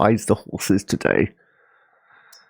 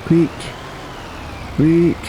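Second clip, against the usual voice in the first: sudden shout; 10 semitones higher than usual. Real, not acted. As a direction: yell it in a panic. Urging the game character.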